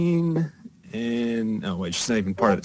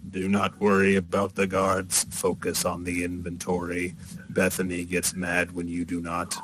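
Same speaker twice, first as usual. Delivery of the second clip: low, quiet, stiff machine-person; stiff warforged; 4 semitones lower, 6 dB quieter than usual. Acted. Warforged telling the girl to mind inventory, low and stiff. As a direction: deliver it stiffly, low and soft, like a construct. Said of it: "all stiff and".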